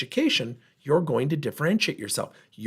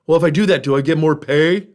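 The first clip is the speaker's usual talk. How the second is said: loud mock whine of a worker who wants more pay; whiny entitled employee; 2 semitones higher, 9 dB louder than usual. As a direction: sneer it like a spoiled employee. Mocking an entitled employee's demand.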